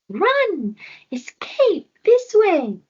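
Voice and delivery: very high, loud urgent cries; urgent call. Acted. Voice calls Floki to flee, high urgent shouts.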